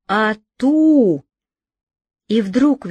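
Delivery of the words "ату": hunting cry. Acted. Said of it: "Шталю в нём послышалось".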